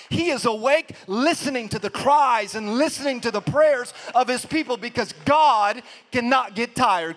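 loud, driving sermon delivery; rousing pulpit proclamation; full-force preaching. Real, not acted. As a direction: preach it hard, punching each phrase.

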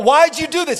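loud, high indignant demand; mock accusation. People turn on the devil over storms, mock outrage.

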